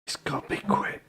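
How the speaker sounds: urgent whisper so the demons next door can't hear; hushed, breathy whisper behind a barricaded door. Acted. Player whispering to the party while bracing the door. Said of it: "I don't know why I'm whispering".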